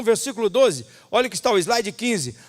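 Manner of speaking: usual speaking voice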